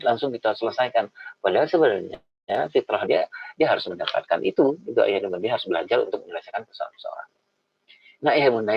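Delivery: ordinary talk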